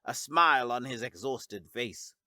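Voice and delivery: plain reading voice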